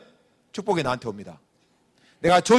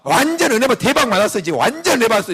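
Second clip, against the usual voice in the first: higher, louder gushing; raving enthusiasm; 6 semitones higher, 4 dB louder than usual. Retold or imitated. Cell leader raving to members, excited. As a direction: rave about the sermon.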